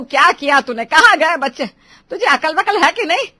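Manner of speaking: loud angry scolding; भड़की हुई. Acted. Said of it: "Poonam और विमला सौम्या पर भड़क रही थी". (ऊँची आवाज़ में डाँट)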